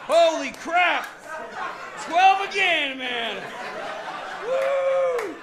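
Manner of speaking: high, loud shout of gambling joy; whooping at the table. Acted. Gambler cheering his lucky hand at the blackjack table, loud whoop. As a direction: yell it like you just hit big.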